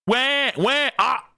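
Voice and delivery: high newborn wail; imitated baby cry. Announcing another birth, mock baby wailing.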